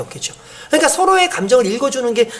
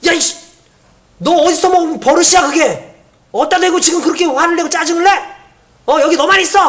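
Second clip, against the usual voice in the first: higher-pitched mock scolding; angry father; 5 semitones higher than usual. Father scolding a child's tantrum, acted angry outburst. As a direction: act out a dad blowing up at his child. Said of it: "화가 나죠".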